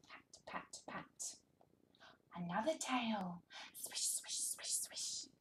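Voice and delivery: soft breathy whisper; whispered sound words. Shown as a demonstration. Reading to toddler, hushed whisper. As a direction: whisper each word to draw him in.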